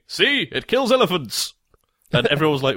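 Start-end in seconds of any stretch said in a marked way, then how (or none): 0.1-1.5 lightly acted, high-pitched boast; mock triumphant demonstrator. Edison showing off to onlookers, mock triumph. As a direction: show off the proof in a raised voice.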